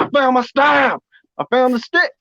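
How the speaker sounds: high, excited exclaim; delighted. Retold or imitated. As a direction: burst out in joy, pitch up. Joy at finding staff.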